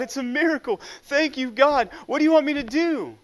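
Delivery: high, loud overjoyed shouting that speeds up at the end; relieved yell. A narrator's reading. Rescued hiker yells up to God with joyful relief. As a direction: shout your thanks with relief. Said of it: "with such relief yells out".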